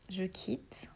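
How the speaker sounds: aparté chuchoté pour soi. Real, not acted. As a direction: murmure à soi-même en cliquant. Aparté en manipulant l'appli. (chuchotement d'aparté)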